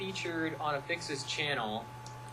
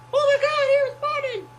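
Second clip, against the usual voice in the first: excited squeal; 11 dB louder than usual. Retold or imitated. She reacts to his reply with a thrilled squeal. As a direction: shriek with delight. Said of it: "And she was like".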